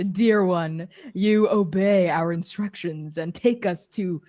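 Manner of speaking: slow, grandiose dark lord proclamation; Lord of the Darkness. Acted. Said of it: "from the shadows, I call out".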